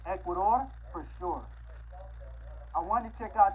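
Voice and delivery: normal talking voice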